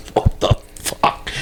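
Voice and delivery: stunned whisper. Retold or imitated. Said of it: "And I'm like".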